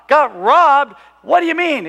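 loud, high disbelieving echo; retold exasperated father; incredulous dad. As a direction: throw it back at the kid, very loud. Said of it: "my dad would get mad at me".

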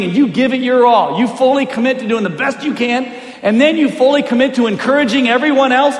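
loud, high, driving rally speech; rally them forcefully, point by point; retold coach's pep talk. Coach dad rallying losing team, impassioned pep talk.

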